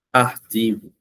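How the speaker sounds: ordinary delivery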